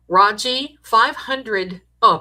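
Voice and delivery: ordinary talk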